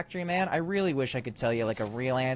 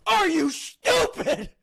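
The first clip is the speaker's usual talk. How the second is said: much higher, louder, slow shouted insult; mock furious yell; 6 dB louder, much slower than usual. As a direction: drag out the insult at the top of your voice. Yelling at the game character.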